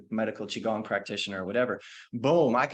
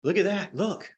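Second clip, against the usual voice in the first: amazed; 4 semitones higher than usual. Retold or imitated. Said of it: "And she went".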